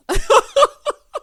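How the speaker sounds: sudden loud high laugh; loud burst of laughter. Real, not acted. Host bursts out laughing at Leah's burn-it-down line.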